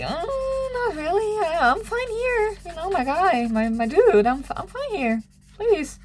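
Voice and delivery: put-on high, pleading girlish voice, half crying. She begs to stay, pleading in a high voice.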